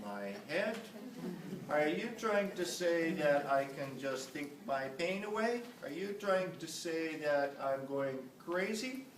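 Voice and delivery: mock indignant patient demanding answers; indignant protest. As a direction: voice the patient's offended objection. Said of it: "standing up in arms and saying".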